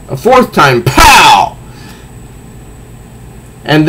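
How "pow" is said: punchy comic-book pow. Acted. Narrator punching out the sound effect, high and sharp. Reading comic fight aloud.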